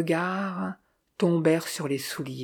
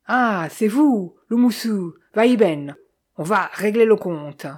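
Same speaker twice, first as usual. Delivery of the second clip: louder, raised threatening voice; threatening, with a raised opening; vibrating menace; 4 semitones higher, 5 dB louder than usual. A narrator's reading. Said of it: "Sa voix vibra, menaçante".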